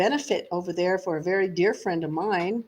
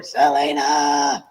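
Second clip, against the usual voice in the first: low, slow, drawn-out call; mock yell; 6 semitones lower, 5 dB louder, much slower than usual. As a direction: imitate a father yelling at the door. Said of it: "start yelling at the door".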